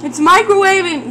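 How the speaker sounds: excited. Real, not acted. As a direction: burst out with delight. Food puffing up in microwave.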